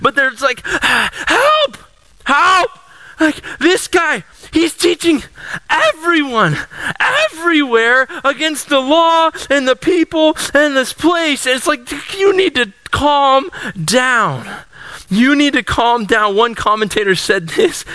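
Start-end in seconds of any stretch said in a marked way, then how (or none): 0.7-2.7 very high, hammed-up yelp of help; mock hysterical crowd calling help; dramatic cry for help. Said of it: "the most dramatic people on the planet Earth". 3.2-11.4 high, mock-outraged accusation; overdramatic accuser. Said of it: "the most dramatic people on the planet Earth". 12.2-16.3 calm down. Pretend. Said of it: "I'm super dramatic".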